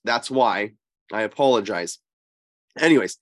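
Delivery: ordinary delivery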